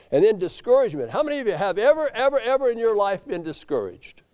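high, fast, forceful preacher; fired-up preaching. Acted. Preacher asking the congregation who has ever been discouraged, fired-up.

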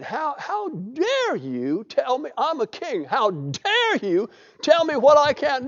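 high, slow, drawn-out outrage; indignant king. Retold or imitated. King Uzziah scolding the priest who stopped him, slow outrage. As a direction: stretch out the how dare you like an offended king.

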